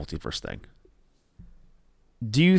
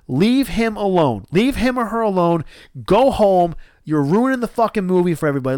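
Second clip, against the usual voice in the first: heated, higher-pitched rant; re-enacted indignant scolding; angry telling-off; 6 semitones higher than usual. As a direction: lay into them, driving each command home.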